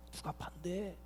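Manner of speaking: very soft acted murmur; hushed questioning. Preacher voices disciples wondering who betrays, hushed questioning. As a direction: drop to a murmur as if asking around.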